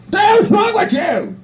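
sudden high-pitched angry yell; indignant outburst. Acted. Patient confronting people in the hall, indignant high yell. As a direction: burst out high at whoever is staring.

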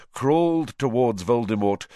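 ordinary delivery, nothing marked

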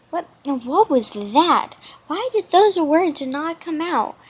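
ordinary delivery, nothing marked

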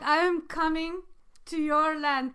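teasing German-accented impression; mock German accent. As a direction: imitate a solemn announcement in a German accent. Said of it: "He's like".

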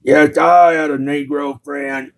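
odd Carl-type character voice, deliberate and drawn out; slow, awkward storyteller character. Pretend. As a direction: tell it stiffly in a put-on drawl.

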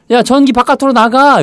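playful high order to the power; mock command. Shown as a demonstration. Teacher ordering electricity out, playful and high. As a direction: boss the electricity out, jokingly. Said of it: "이거잖아요".

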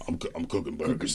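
low, quiet dopey Bob voice; Bob's voice. Retold or imitated. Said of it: "that's how Bob talks".

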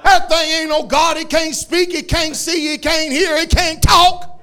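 loud, high hammering lines; fiery preaching. Real, not acted. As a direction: pound each "can't" home. Preaching at full force.

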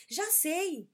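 put-on a boy who just had an idea; excited. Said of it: "ele disse em voz alta".

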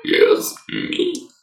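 frog voice. Acted. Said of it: "I love my frog voice".

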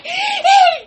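high shouted accusation; courtroom outburst. Pretend. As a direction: point and yell it.

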